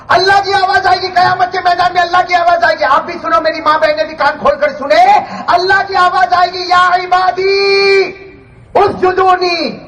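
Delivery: high, ringing preacher's proclamation; majestic voice of God. Acted. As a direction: call it out with awe, stretching the Arabic words. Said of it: "اللہ کی آواز میں کیسا جلال ہوگا". (جلال اور رعب بھری پکار)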